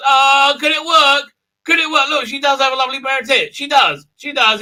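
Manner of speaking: high, loud, eager exclaiming; hammed-up breathless hope; mock hopeful excitement. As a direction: get carried away in a high put-on voice. Voices his hopeful reaction.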